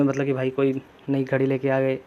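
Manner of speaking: usual speaking voice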